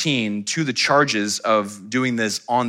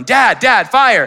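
high, loud cry for dad; yelling; 12 semitones higher, 9 dB louder, slower than usual. Retold or imitated. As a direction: shout the alarm at dad. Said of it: "I start yelling".

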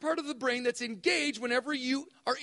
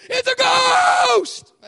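very loud, much higher, slow shriek of terror; terrified shout; 11 semitones higher, 14 dB louder, much slower than usual. Acted. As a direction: scream it like the disciples seeing a ghost. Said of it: "this is how Bill reads this part".

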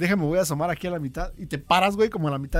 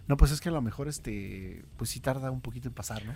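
low, soft self-reassurance; calming down; 4 semitones lower, 7 dB quieter than usual. Acted. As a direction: let the voice sink as you talk yourself calm. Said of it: "te empiezas a calmar". (tranquilizándose, en voz baja)

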